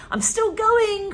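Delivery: high, loud, slow screech; Grandma screeching down from the attic; screechy old voice. Acted. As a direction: screech it from far above like an old crone. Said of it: "an old screechy voice from above".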